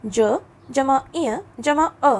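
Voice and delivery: ज जमा इय जमा अ drawn out part by part; slow spelling out. Shown as a demonstration. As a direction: spell it out slowly for pupils. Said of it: "ये ध्वनि के काम आता है". (धीरे-धीरे अक्षर जोड़ते हुए)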